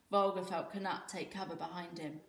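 plain reading voice

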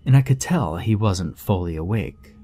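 plain reading voice

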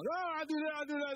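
high, taunting jeer, lightly played; imitating someone squaring up to fight; mock aggressor. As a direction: needle them like a street bully. Aggressor getting in your face.

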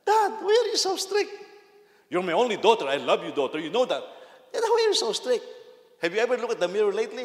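put-on high, loud, laughing girl's voice; teasing daughter. Daughter teasing her father.

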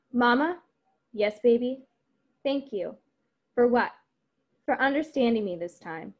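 lively read-aloud of the script's dialogue, voices lightly acted; reading a play aloud. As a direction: read the scene to the class, giving the lines some life.

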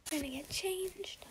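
soft breathy whisper. Real, not acted. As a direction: whisper it so nobody wakes. Whispering, family asleep.

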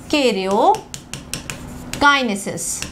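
"karyokinesis" stretched very slowly, syllable by syllable; drawn-out dictation. Shown as a demonstration. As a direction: draw out "karyokinesis" for note-takers. Repeats the term slowly for class.

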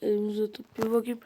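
ordinary talk

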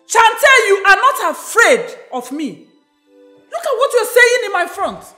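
indignant, shouted reproach; angry scolding. Acted. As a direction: scold your daughter at high pitch. Outraged mother scolds defiant daughter.

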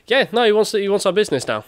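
high, loud comic backtracking; mock hasty agreement. Retold businessman suddenly agreeing, scared.

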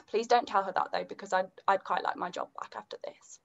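quiet, confidential aside; hushed confiding. Acted. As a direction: drop your voice as if sharing a secret. Confides, fearing the boss finds out.

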